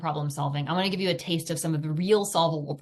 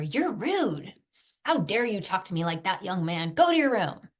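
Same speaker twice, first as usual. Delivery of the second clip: scolding parent; 2 semitones higher than usual. Retold or imitated. Mother sending rude son to his room, mock angry. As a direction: build to a sharp order at the end.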